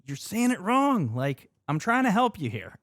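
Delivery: high-pitched mock-exasperated plea; hammed-up exasperation at a mistake; exasperated correcting. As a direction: push the pitch up, pleading to be helpful. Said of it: "but it's like".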